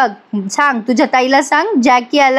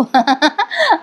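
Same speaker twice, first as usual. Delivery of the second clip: a quick amused laugh, pitched up; short laugh; 4 semitones higher than usual. Real, not acted. Narrator laughing at the aunt's midnight ghostly visit, short laugh. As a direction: laugh briefly at the joke.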